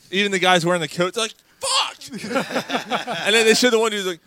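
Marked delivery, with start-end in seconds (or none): 1.6-2.0 very high imitated yelp; mock pained yell. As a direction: squeal it like someone just got stung. Imitating stung men in coats.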